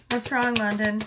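ordinary delivery

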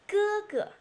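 "哥哥" first syllable high and held, second dropping short; light neutral tone. Shown as a demonstration. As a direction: hold 哥 high, then let the second fall lightly. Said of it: "repetition of the first one".

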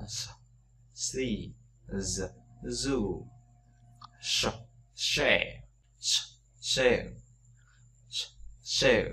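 si and she hissed with no voice; English s and sh drill; hissing S and SH. Shown as a demonstration. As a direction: push air through the teeth for s and sh. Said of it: "âm s thì nó không rung".

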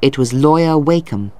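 plain reading voice

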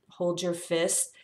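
ordinary delivery

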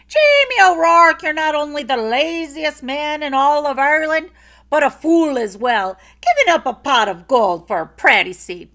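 loud, high scolding wife; furious. A narrator's reading. Eileen scolding her foolish husband, loud and furious. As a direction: tear into him. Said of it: "she was furious".

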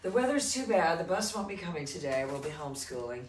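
high, loud, excited repeat. Retold or imitated. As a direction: belt it out gleefully. Repeats the quote, much louder.

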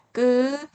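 tense the onset of 끄, no air. Shown as a demonstration. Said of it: "이렇게 읽으시면 되시고요". (된소리로 높게 끄)

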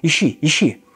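high, frantic, accusing widow's voice; a desperate woman hurling accusations, pitched high. Acted. Katerina Ivanovna demands they search her, high and frantic.

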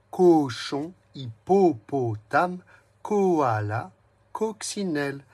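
word list read out stressing the O sound. Shown as a demonstration. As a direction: lean on the O in every word so the child hears it. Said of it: "faire attention au bruit".

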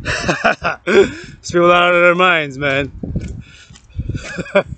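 slow, laughing through the words; a laugh of disbelief at leaders. Real, not acted. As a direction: chuckle while you say it.

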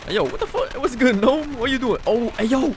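high, loud startled exclamation; startled shout. Real, not acted. Player spotting a gnome in the game, startled shout. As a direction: react to a surprise gnome.

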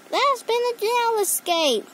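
high-pitched urgent phone report; high voice of an alarmed caller. A narrator's reading. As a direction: raise the alarm down the line, high.

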